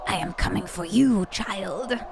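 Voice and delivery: low menacing monster voice; the monster promising it is coming; a hint of a lurking monster; creepy voice in the head. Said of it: "that voice in the back of your head".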